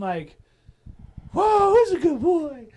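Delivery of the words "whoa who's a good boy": put-on high, loud squealing baby talk to a dog. Talking to a charging pit bull, loud high baby talk. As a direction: coo at the big dog like a puppy.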